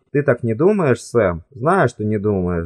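plain reading voice

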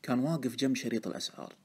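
usual speaking voice

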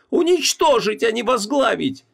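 very high-pitched furious screaming; wild yell. A narrator's reading. Wife screaming at husband to look at himself, furious. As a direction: scream at him, stretching the words. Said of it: "дико заорала Ванесса".

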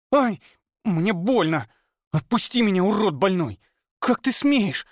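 put-on high, pained, furious girl's voice; hurt and outraged, spitting words; girl in pain snapping through her teeth; through gritted teeth. A narrator's reading. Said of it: "Процедила она сквозь зубы".

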